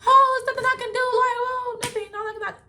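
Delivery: a high, flippant imitation of her own shrugging; resigned inner voice. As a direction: toss it off high as if it doesn't matter. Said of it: "'cause I'm like".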